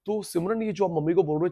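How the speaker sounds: normal talking voice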